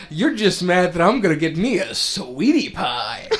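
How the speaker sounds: high, loud cocky quote; Zidane voice. Retold or imitated. Zidane teasing Blank, high loud put-on voice. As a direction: tease it like a smug flirt. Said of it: "And Zidane says".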